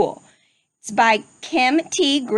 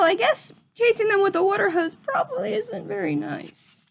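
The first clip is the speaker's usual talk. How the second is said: sheepish little pig; 9 semitones higher than usual. Acted. Rufus owning up to the principal, high sheepish piglet voice. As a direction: admit it like a guilty little pig.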